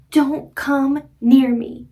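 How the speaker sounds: slow, loud mock-angry warning. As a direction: act out an angry heart, slowly. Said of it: "When I get really angry".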